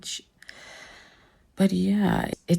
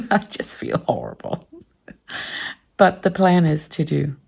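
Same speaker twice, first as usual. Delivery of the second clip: ordinary delivery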